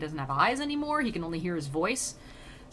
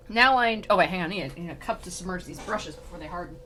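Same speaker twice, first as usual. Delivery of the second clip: ordinary delivery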